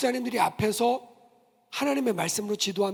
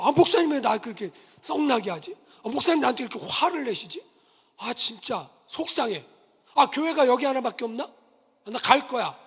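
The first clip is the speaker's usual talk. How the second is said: sulky hurt complaint; 4 semitones higher, 5 dB louder than usual. Retold or imitated. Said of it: "서운하단 말이에요".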